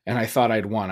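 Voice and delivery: normal talking voice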